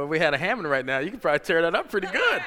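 ordinary talk

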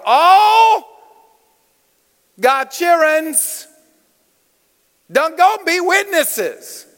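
high, drawn-out folksy preacher dialect, big on ALL; folksy bad-English dialect. Preacher rallying congregation, high drawn-out folksy dialect. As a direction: proclaim it slowly in folksy dialect, pausing between phrases. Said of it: "it's bad English".

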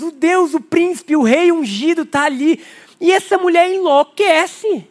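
ordinary delivery

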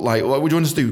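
normal talking voice

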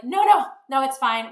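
fending him off loudly; firm refusal. Retold or imitated. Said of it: "And so I was like".

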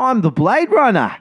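put-on the South African accent, finally found; nailed South African accent. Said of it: "that's the money shot".

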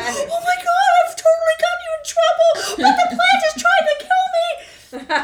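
very high, loud, laughing cries; hammed-up hysterical apology; frantic panic. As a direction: wail it half-laughing. Frantic apology while hallucinating.

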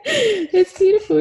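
high, laughing "It's beautiful"; laughing through it. Real, not acted. Moved by her son's story, laughing.

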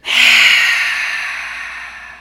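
long loud breathy exhale; dramatic sigh. Shown as a demonstration. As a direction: heave a big releasing sigh. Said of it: "Two more".

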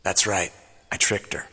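whispered aside about the trick. Acted. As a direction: confide it under your breath. Whispered admission.

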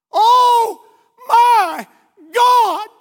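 high, full-throated scream of terror; reenacted horrified scream; retold scream. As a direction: shriek it out as if seeing the crash. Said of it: "she screamed these words".

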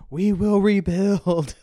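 slow, loud, high and grand; dramatic declaration. Retold or imitated. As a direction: proclaim it like a war leader. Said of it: "she FaceTimes me and goes".

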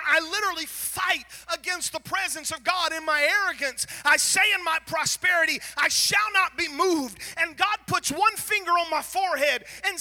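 loud, high, fast fervent preaching; fiery preaching. Acted. Preacher driving home his pride before God, full force. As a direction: preach it hard to the congregation.

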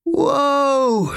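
very high, loud alarmed cry; anxious whoa. A narrator's reading. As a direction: yelp it, unsure where the slide ends. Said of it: "beginning to feel anxious".

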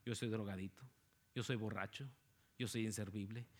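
a hint of a weary addict confessing; softly mimicked confession. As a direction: murmur them like someone beaten down. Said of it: "Cuántas personas no hemos ministrado".

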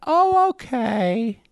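high, drawn-out caricature voice; hard-of-hearing wife. Acted. Wife accepting husband's explanation, high comic caricature. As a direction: sing it out slowly, high up. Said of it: "loves the voice".

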